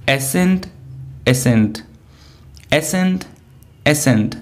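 pronunciation model for "एसेंट"; pronunciation drill. Shown as a demonstration. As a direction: say "एसेंट" clearly, over and over for the class. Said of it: "एसेंट and एसेंट दोनों का pronunciation".

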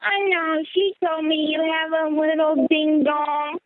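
normal talking voice

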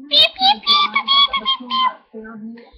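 very high steady beeping; robotic beeps. Pretend. Kid playing at a beeping machine. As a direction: beep like a machine, fading out.

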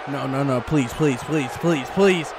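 low, soft, repeated begging; anxious pleading. Real, not acted. Commentator begs Carter to stop, pleading low. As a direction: plead under the breath, dreading it.

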